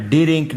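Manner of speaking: डिरिंक with a short "i" between d and r; wrong added vowel. Shown as a demonstration. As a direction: say डिरिंक as "di-rink". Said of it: "नहीं पढ़ना".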